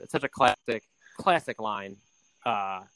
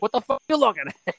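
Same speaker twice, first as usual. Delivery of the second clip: high, hammed-up wiseguy snap; mock yell at waiter; 9 semitones higher than usual. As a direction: bark at the waiter in a put-on voice. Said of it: "he just yells at the waiter".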